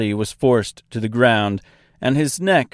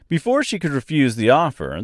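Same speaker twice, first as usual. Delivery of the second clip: ordinary delivery, nothing marked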